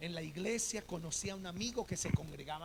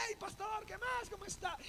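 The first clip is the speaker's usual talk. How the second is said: high, bright mock hello; imitated eager greeting; overly friendly greeting; 11 semitones higher, 3 dB quieter than usual. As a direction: pitch it way up like a stranger acting like an old friend. Stranger suddenly greets pastor warmly.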